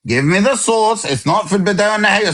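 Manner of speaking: ordinary delivery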